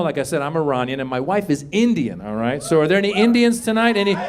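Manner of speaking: ordinary talk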